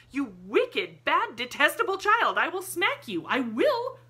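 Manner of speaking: exasperated governess shrieks at Lottie; frantic scolding. A narrator's reading. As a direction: boil over at the kicking child. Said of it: "helplessly or excitedly".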